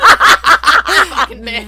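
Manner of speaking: laughing shout. Real, not acted. Laughing outburst at murder joke.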